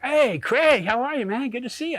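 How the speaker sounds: high, overly chummy greeting; mock friendly. Greeting Craig with overdone high cheer. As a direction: pour on fake friendliness. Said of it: "I'm like".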